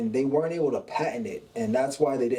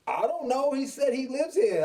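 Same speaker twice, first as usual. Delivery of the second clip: much higher, puzzled retold reply; 10 semitones higher than usual. Husband answering wife about the stranger, puzzled. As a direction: protest that you have no idea, pitched up.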